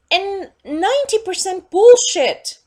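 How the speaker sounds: loud ranting punch on ninety; angry outburst. Real, not acted. Vlogger ranting to camera, loud and fed up. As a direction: blurt out the verdict in disgust.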